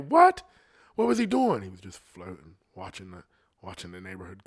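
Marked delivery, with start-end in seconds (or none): 0.1-1.6 high, loud burst of disbelief; shocked disbelief. Acted. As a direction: react like you can't believe it. Shocked reaction to floating neighbour.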